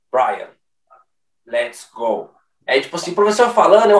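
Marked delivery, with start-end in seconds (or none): 0.1-2.3 grave, lento e pausado; fala do Toretto. Retold or imitated. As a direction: imita o Toretto, respira antes de falar. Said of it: "o Toretto fala pausadamente". (fala do Toretto, grave e pausada)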